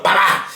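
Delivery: punchy vocal sound effect; imitated gun cocking. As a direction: voice the click of a gun being cocked. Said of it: "he done cocked the gun".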